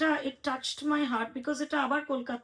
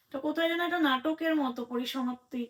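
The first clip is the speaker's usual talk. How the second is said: ordinary delivery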